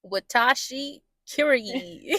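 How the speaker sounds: slow careful repeat. Pretend. Repeating the Japanese phrase slowly.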